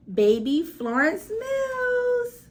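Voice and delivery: drawn out, high showman call; MC announcement. Acted. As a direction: stretch the name like a stage host. MC announces performer.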